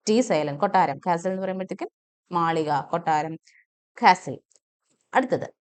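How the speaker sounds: ordinary talk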